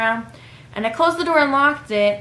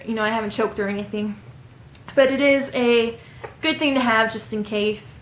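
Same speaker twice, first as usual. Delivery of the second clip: ordinary talk, nothing marked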